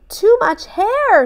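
put-on high boy voice, fed up; little boy sick of crowds; frustrated boy Ben. A narrator's reading. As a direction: Ben grumbling at too much hair. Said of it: "said Ben".

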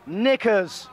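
loud, crisp English "Knickers."; English accent. Shown as a demonstration. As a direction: say it like a proper Englishman. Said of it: "I'll say it in English".

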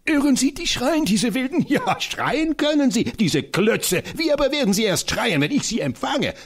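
high, fiery voice of the tiny professor; rally for battle with comic zeal; an excitable old scholar, pitched high; eccentric little professor. Acted. Professor mocks the attackers.

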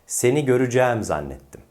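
"göreceğim" contracted to gö-rü-cæm; spoken form. Shown as a demonstration. Teacher repeats the model sentence, "göreceğim" as görücæm. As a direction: run "göreceğim" together, ending in open cæm.

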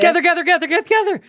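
high, rapid chant of gather; urgent calling. Real, not acted. Player urging the pickup before he drifts off, high and insistent. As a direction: hurry the loot up, high and pressing.

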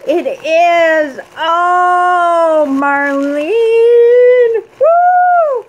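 rising to a high, loud shriek of joy; excited squeal. Real, not acted. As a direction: squeal the friend's name as the gift is revealed. Opening a gift from a friend.

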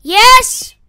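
loud triumphant yes; excited shout. Real, not acted. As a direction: cheer it out, very high and loud. Triumph in the game.

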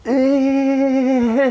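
high, drawn-out 「えー」 of disbelief; shocked groan. Acted. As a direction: act out stunned disbelief. Audience's shocked reaction. (聞き手になりきって驚く「えー」)

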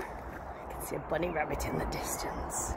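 whispering, very soft and low; hushed whisper. Real, not acted. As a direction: whisper it like spotting a rabbit in the distance. Spotting a rabbit far off.

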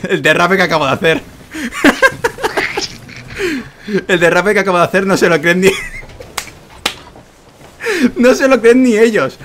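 high, loud words through laughter; laughing. Real, not acted. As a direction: laugh while you brag. Laughing at his own ship drift.

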